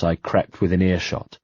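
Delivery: plain reading voice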